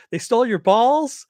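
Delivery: high, loud, jeering mock heckle. Sinestro heckles Aquaman at the party, high and loud. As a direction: heckle him across the party. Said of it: "having Sinestro heckle him".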